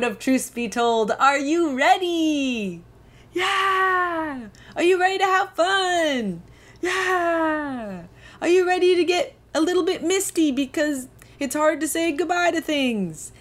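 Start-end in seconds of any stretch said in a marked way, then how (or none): 1.1-2.8 slow, drawn-out hype question; hype host. Pretend. Hyping listeners for episode. 3.3-4.5 high cheer from a pretend audience; cheering crowd. 4.7-6.4 hype host. Pretend. Hyping listeners for episode. 6.8-8.0 high cheer from a pretend audience; cheering crowd. 8.4-13.3 hype host. Pretend. Hyping listeners for episode.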